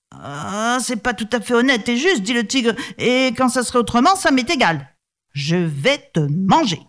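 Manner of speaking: menacing tiger. Acted. Tiger refuses, will eat him.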